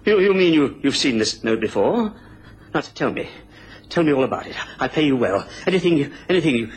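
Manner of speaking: ordinary talk, nothing marked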